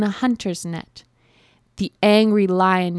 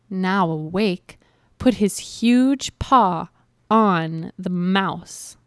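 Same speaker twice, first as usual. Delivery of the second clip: ordinary delivery, nothing marked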